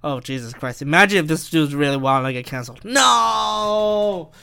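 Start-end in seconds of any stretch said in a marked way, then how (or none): 2.8-4.3 long drawn-out wailed no; hammed-up cry of despair; mock anguish. Podcaster imagines getting canceled, drawn-out mock anguish.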